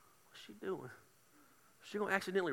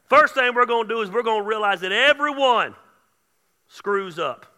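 loud, high declaration to the room; full-force preaching; 6 semitones higher, 14 dB louder than usual. Real, not acted. Preacher announces his first step, in full-force preaching. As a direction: launch the first point at full volume.

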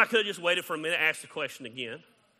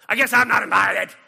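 loud, higher, hammed-up sulk; mock sulky complaint; 5 semitones higher, 14 dB louder than usual. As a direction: whine it like a pouting left-out friend. Acted sulky excluded person.

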